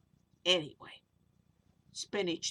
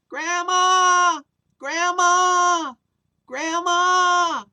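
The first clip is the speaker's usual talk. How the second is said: long high falling calls, much louder; calling out; 10 semitones higher, 14 dB louder, much slower than usual. Acted. Kids calling for lost grandma, loud long calls. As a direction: shout her name down the street, searching.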